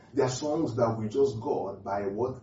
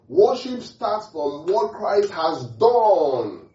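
high, loud and very slow; drawn-out proclamation; 6 semitones higher, 5 dB louder, much slower than usual. Real, not acted. Preacher repeating the main point to believers, drawn-out proclamation. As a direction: stretch it into a long cry, holding DONE. I'll say that again.

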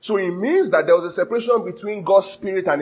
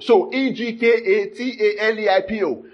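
ordinary delivery

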